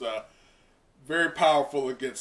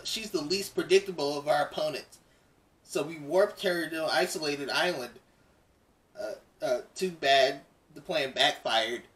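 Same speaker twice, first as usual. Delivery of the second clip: lightly voiced frog girl, matter-of-fact; a hint of a cool, level hero girl; calm Froppy. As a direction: reader voicing Froppy explaining the plan, mildly in character. Froppy's lines read in voice.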